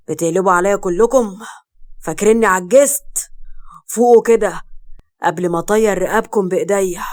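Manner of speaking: loud, forceful threat; angry. A narrator's reading. Father threatening his rebellious family, angry. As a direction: thunder it out in stressed bursts. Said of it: "محمد وقف وقال بغضب".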